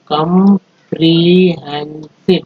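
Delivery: Comprehensive drawn out slowly in parts; slow syllable-by-syllable. Shown as a demonstration. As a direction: stretch comprehensive part by part. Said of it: "चार words बना है". (Comprehensive धीरे-धीरे टुकड़ों में)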